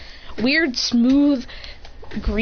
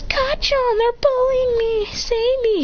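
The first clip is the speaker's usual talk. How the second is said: high-pitched helpless whine; whiny pleading kid; 12 semitones higher, slower than usual. Acted. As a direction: beg high like a bullied child. Kid begs to be rescued.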